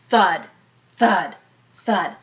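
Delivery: ordinary delivery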